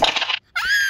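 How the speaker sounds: long, very high squeal; excited squeal. Real, not acted. Player boasting to viewers, excited squeal. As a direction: let out a drawn-out high shriek. Excited after success in game.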